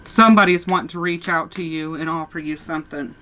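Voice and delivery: ordinary delivery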